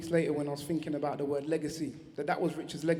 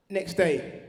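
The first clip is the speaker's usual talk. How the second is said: normal talking voice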